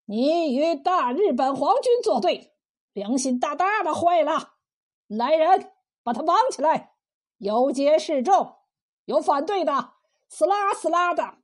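wrathful military commands in an altered voice; condemn, then order emphatically; angry officer. Acted. Enemy captain condemns the cook and orders her tied up, angrily. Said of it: "怒气冲冲地说".

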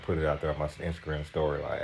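ordinary talk

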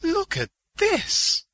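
high, loud, drawn-out call. A narrator's reading. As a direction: call across the room in a high voice. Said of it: "Diana called from behind one of the pigs".

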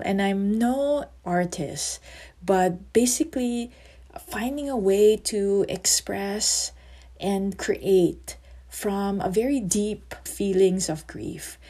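ordinary delivery, nothing marked

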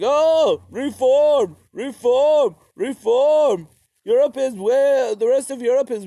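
high, loud squeaky mock tsar chanting reform; mock Peter the Great. Comic narrator mocking Peter the Great's reforms, high and loud. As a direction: ham it up as a giddy reformer tsar. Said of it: "Peter the Great was like".

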